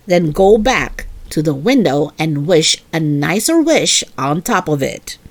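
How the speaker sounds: low, firm scolding mother voice; stern mother. Acted. Mother refusing Junie B's bedtime wish, low and stern. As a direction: put your foot down like a strict mom.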